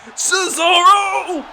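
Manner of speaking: slow, ring-filling announcer call; drawn-out hype call. Acted. Commentator announcing the Cesaro swing, drawn-out hype call. Crowd-pleasing signature move starts.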